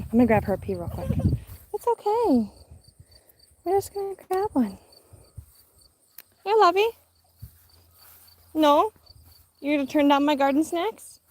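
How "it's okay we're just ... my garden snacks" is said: high playful coaxing, mock offended at the end. Real, not acted. Mom teasing baby who refuses her garden snack, high baby talk.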